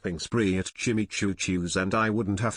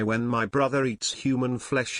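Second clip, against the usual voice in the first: ordinary delivery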